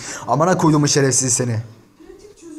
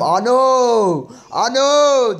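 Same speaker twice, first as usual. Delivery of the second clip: high, drawn-out imitated cry; mock yell; 10 semitones higher, 4 dB louder, much slower than usual. Streamer imitating the rival's mother yelling, high mock cry. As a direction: yell it out like someone crying out, pitch leaping up. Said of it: "diye bağırır".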